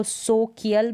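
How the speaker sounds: "सोकियल" with a hard k mid-word; wrong k pronunciation. Shown as a demonstration. Teacher shows how not to read social, wrong k pronunciation. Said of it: "भी नहीं पढ़ रहे हैं".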